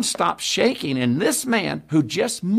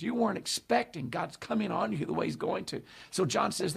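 ordinary talk

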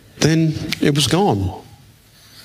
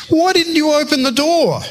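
very high mock outcry; exclaimed reproach; 14 semitones higher than usual. Pastor answers the story on the phone, mock incredulous outcry. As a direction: imitate the same incredulous outburst, pitched way up. Retold pastor repeats challenge.

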